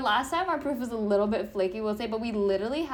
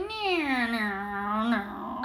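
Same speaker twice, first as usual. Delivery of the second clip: vocal race engine dropping through the gears; imitated engine downshifting; much slower than usual. Host imitating her driver's car downshifting, vocal engine noise. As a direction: buzz out an F1 engine braking. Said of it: "It's going".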